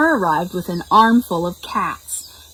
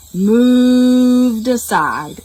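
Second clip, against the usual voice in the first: slow heavy reluctance; 4 semitones higher, 6 dB louder, much slower than usual. A narrator's reading. Cows finally give in.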